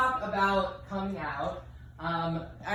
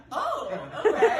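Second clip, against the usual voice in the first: taken aback; 7 semitones higher, 6 dB louder than usual. Retold or imitated. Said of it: "And they're like".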